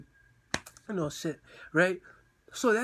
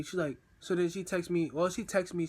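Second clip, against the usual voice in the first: normal talking voice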